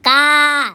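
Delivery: का with a long, open, held आ; का drawn out slowly, mouth open on the आ; long आ sound. Shown as a demonstration. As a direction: stretch the vowel of का to demonstrate the sound. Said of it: "आ की मात्रा लगा दिया तो".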